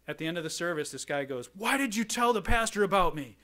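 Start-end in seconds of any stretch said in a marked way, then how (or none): 1.5-3.4 acted outburst, loud and high-pitched; incensed. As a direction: imitate an outraged man demanding an explanation. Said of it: "he was incensed".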